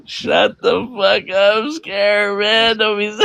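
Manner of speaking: high, slow, laughing "shut up"; laughing protest. Real, not acted. Reacting to the friend's crude story, high laughing protest. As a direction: crack up while telling him off.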